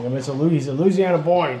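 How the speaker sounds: normal talking voice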